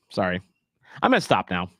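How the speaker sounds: ordinary delivery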